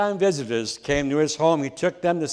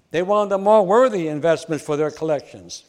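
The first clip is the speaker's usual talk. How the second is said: ordinary talk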